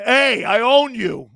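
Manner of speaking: slow, loud gloating taunt; drawn-out boast at rival fans. Retold or imitated. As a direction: rub it in slowly like a cocky quarterback. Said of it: "He's telling Bear fans".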